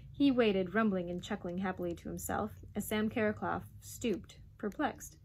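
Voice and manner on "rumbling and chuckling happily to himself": plain reading voice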